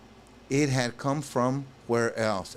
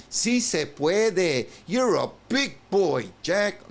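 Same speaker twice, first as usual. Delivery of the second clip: higher, louder hype cheer; 8 semitones higher, 5 dB louder, slower than usual. Pretend. Cheering Jack on for finally being brave, excited. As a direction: pump him up like a fan in the stands.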